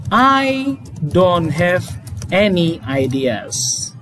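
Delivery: slow, clear negative: I don't have any ideas; teacher modelling a negative sentence, slowly; slow model sentence. Shown as a demonstration. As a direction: read it slowly for the class. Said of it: "kalimatnya negatif".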